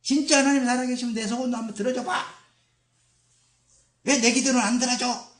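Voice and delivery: loud, very high-pitched acted complaint; hammed-up shouted grievance; mocking challenge to God. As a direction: demand it indignantly, like an aggrieved believer. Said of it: "예수님을 조롱할 수 있습니다".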